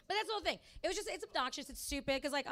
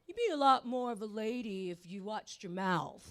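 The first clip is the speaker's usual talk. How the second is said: a hint of a smug, preachy cop voice; lightly mock the patronizing horse cop; condescending cop. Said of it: "And he goes".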